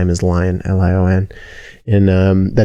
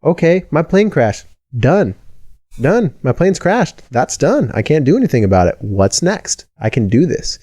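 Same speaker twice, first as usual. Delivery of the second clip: higher-pitched, matter-of-fact, shrugging it off; tough resilient self-talk; 7 semitones higher than usual. Retold or imitated. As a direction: brush off the disaster and move on. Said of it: "Instead of this".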